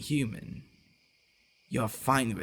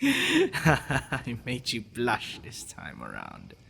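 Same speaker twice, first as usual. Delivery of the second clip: teasing laugh; slower than usual. Acted. Teasing the blushing partner.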